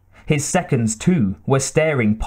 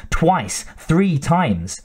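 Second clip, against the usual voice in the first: plain reading voice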